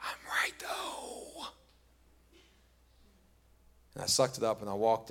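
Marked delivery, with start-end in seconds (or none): 0.0-1.5 a stubborn little whisper, trailing away; whispered protest. Retold or imitated. Whispering to himself on the porch, still sure he's right. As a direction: insist under the breath that he's right. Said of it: "I'm like".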